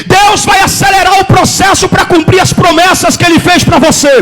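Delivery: rhythmic, impassioned sermon shout; full-force preaching. Real, not acted. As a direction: fire up the congregation with each promise.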